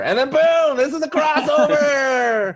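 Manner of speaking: loud, high, slow announcement; hyped-up reveal; excited. Real, not acted. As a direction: sell it like a trailer. Hyping a joke crossover pitch.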